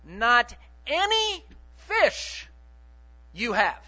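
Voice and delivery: slow, word-by-word Greek order; slow literal reading. Retold or imitated. Preacher giving the verse's literal word order, slow. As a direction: read it out stiffly, one word at a time. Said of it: "literally it reads like this".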